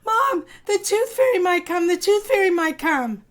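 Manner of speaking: high, loud gleeful chant; excited child shouts to mom about the tooth fairy, high and loud; excited shouting. Acted. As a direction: bounce with excitement. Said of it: "screamed Elda".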